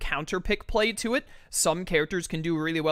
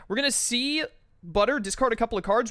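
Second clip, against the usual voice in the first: ordinary delivery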